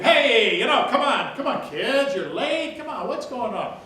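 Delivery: loud, high coaxing shout; yelling a little. Shown as a demonstration. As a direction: yell to round up dawdling kids. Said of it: "Yelling, you can yell a little bit".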